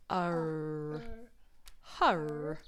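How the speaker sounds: hur sounded out slowly; sounding out. Shown as a demonstration. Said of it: "So we've got".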